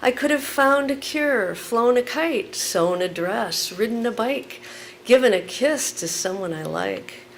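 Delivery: loud, emphatic spoken word; poem performance. Acted. Performs poem Killing Time.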